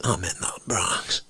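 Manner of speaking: low, soft whisper; hushed caller; whispering down the phone. Retold or imitated. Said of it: "It's Foch".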